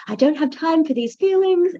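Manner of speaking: mock the old self that shoves feelings aside; lightly voiced impatient brush-off; dismissive inner voice. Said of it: "instead of".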